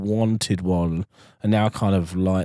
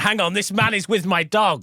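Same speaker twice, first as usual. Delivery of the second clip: mock outraged owner; 8 semitones higher than usual. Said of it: "they're like".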